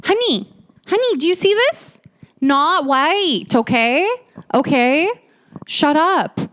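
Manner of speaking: high, sassy put-down; sassy retort. Retold or imitated. Answering the roommate's race card, sassy and high. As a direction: talk down to her with mock sweetness then snap. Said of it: "And I'm like".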